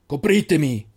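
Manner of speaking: loud call from afar; shouted. A narrator's reading. The old keeper shouts to the boys for cover. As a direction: shout the order across the field. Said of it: "urlò il custode da lontano".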